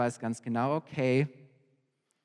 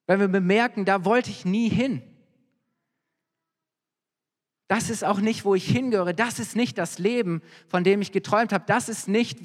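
impassioned preaching; 6 semitones higher than usual. Acted. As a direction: drive the point home from the pulpit. Preacher building to full force.

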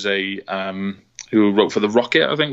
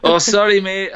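mock Scottish accent, pitched higher and louder; 10 semitones higher, 5 dB louder than usual. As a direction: do a quick Glasgow-style Scottish apology like the Limmy sketch. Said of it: "I didn't mean to do the accent".

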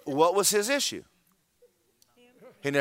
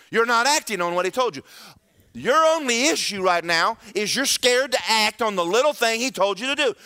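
high, raised preacher's voice; full-force preaching; 8 semitones higher, 5 dB louder than usual. Acted. Preacher confronting the congregation about not acting, high and forceful.

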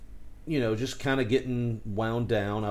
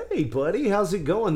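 high nervous greeting; 8 semitones higher, 3 dB louder than usual. Retold or imitated. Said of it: "you're like".

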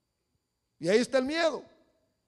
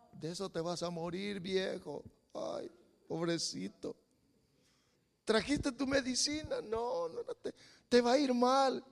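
mock pity; 7 dB quieter than usual. Voicing a negative, pitying relative.

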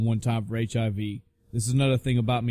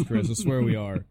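ordinary delivery

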